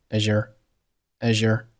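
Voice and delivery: "Azure" with a zh glide joining as and your; linked pronunciation. Shown as a demonstration. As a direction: say "Azure" as one linked word, zh between the vowels. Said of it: "自然的带出一个日的音".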